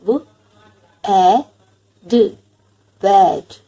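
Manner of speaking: slow dictation of sad, mad, lad, wad; teacher's pronunciation demo. Shown as a demonstration. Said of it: "listen carefully as I pronounce them". (शिक्षक की तरह साफ़ उच्चारण)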